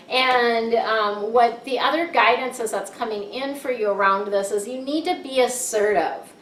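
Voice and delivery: ordinary delivery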